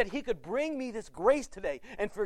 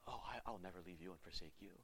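much softer, gentle reassurance; tender promise; 12 semitones lower, 19 dB quieter, faster than usual. Pretend. God promising the unfaithful listener, tender and soft. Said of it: "But instead he comes to you and says".

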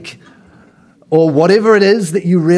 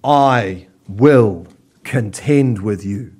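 low, slow, solemn "I will contend with you"; voice of God; 6 semitones lower, slower than usual. Acted. God summoning his people, low and solemn. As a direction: announce the charge like a divine judge. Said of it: "he says".

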